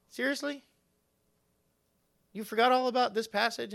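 high-pitched disbelieving pastor friend; high mock-astonished question; mock incredulity. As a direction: imitate a friend who can't believe it. Voices pastor friend's incredulous reply.

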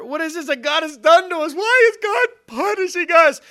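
high, loud panicked outcry; afraid. Acted. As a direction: cry out in alarm to each other. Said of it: "they were afraid, saying to one another".

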